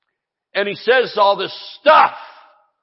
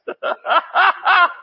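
shouted high laugh of joy; high whooping laugh; 14 semitones higher than usual. Real, not acted. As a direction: burst into an excited laugh.

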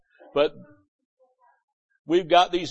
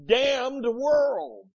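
ordinary talk

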